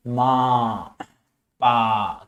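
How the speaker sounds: low, drawn-out single syllables; lightly imitated baby babble; baby's monosyllables. Shown as a demonstration. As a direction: draw out one syllable like a baby. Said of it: "क्या बोलेगा".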